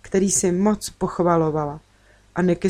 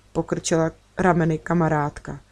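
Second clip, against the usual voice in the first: plain reading voice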